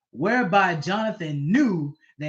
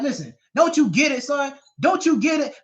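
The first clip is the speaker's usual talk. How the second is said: angry father; 7 semitones higher than usual. Retold or imitated. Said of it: "he's like".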